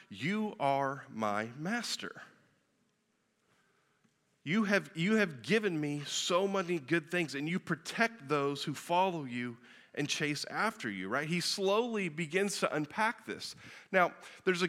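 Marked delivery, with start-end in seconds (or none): 0.1-11.1 slow, hushed declaration. Acted. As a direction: let "you are my master" settle slowly and gently. Quoting David's psalm again.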